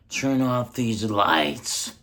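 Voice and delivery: loud, slow yelled order; dad yelling. Retold or imitated. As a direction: bellow it like an angry dad at night. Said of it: "My dad goes like this".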